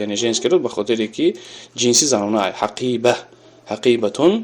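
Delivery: normal talking voice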